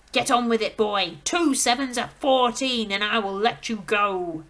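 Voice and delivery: put-on bully the boy into answering; threatening headmistress. Headmistress threatens dangling Rupert, loud and menacing.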